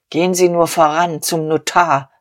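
ordinary delivery

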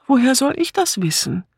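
high, helpless plea; pitiful whine up high; plaintive. A narrator's reading. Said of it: "fragte sie kläglich".

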